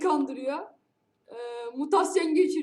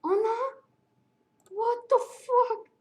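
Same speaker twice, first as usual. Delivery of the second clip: shocked; 7 semitones higher, much slower than usual. Real, not acted. Shocked reaction.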